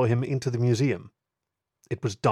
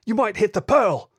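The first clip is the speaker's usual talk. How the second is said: much higher, loud desperate yell; panicked shout; 10 semitones higher, 6 dB louder than usual. Acted. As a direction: scream a warning at him. Inspector still yelling at constable.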